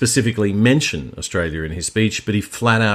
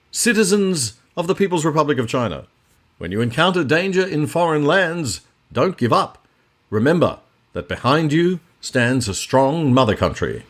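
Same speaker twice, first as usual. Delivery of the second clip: raised-pitch vintage newsreel narrator; old English newsreel voiceover; 6 semitones higher than usual. Shown as a demonstration. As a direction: announce it like a 1940s newsreel. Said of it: "Broke into my old English newsreel voiceover voice there".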